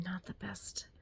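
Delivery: sheepish whispered confession; whispering. Real, not acted. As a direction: whisper it, a little embarrassed. Admits it sheepishly.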